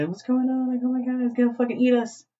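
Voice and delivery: high, rising freak-out; mock panic. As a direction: imitate frightened kids panicking in a high voice. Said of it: "freaking out".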